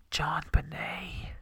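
slow, hushed whisper. Real, not acted. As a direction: whisper it with a dramatic pause before the name. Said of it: "you have to whisper that".

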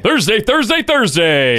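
booming hyped announcer; monster truck ad. Acted. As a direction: shout it like a truck rally ad.

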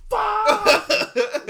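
very loud, high-pitched curse; reenacted outraged yell. Fan hears Jalen's name announced, outraged yell. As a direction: explode as if the pick just went wrong. Reliving hearing the wrong pick.

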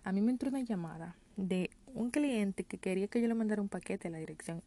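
usual speaking voice

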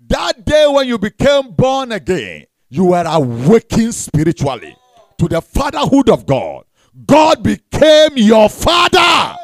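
emphatic pulpit delivery, swelling at the end; preaching. Acted. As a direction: proclaim it to the whole congregation. Preaches at full force.

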